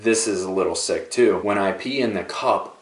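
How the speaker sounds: ordinary talk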